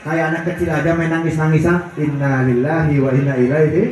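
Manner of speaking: scoffing bystander. Retold or imitated. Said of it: "dia bilang begini, saya dengar tuh suaranya".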